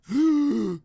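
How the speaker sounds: loud high dramatic gasp; mock gasp. Loud dramatic gasp at spotting John Cena. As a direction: gasp in fake shock.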